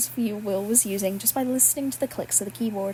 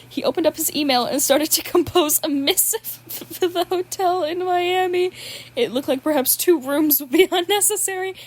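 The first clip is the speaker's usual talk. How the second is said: laughing while reading; 6 semitones higher, 3 dB louder than usual. Real, not acted. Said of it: "all of my reactions were genuine".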